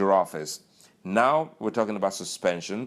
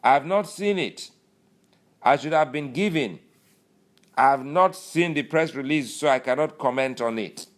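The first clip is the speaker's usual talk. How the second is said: high, loud mock denial; imitating a loud, high-pitched stonewall; defensive Lawal; 7 semitones higher, 5 dB louder than usual. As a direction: insist loudly, pitched up. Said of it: "And he goes".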